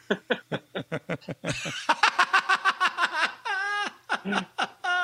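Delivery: long high-pitched laugh; high laughing fit. Real, not acted. Big laugh at joke.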